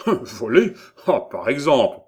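indignant protest. A narrator's reading. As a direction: scoff at the accusation, offended. Scholar protests at accusation of theft. (protestation indignée)

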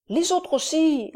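slow, high little voice; a timid little boy answers; small child. A narrator's reading. Dole answers Doniphan, slow small child's voice. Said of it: "répliqua Dole".

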